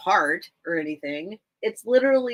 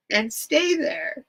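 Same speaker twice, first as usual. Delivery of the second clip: choked up, higher and drawn out; tearful reading; 4 semitones higher, slower than usual. Real, not acted. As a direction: read it through real tears, slowly. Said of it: "why is this making me cry".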